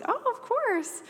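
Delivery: high, put-on agreeable voice; over-sweet agreement. Speaker accepting a preaching invitation on the phone, over-sweet.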